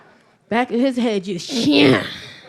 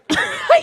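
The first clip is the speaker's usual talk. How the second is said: breathless laugh; 6 dB louder than usual. Real, not acted. Laughing in disbelief.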